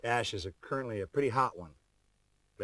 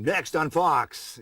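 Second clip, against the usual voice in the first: slow, higher, louder TV announcer read; 6 semitones higher, 3 dB louder, much slower than usual. Shown as a demonstration. Star demos a regular voiceover session line, slow announcer voice. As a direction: stretch it out like a network promo announcer.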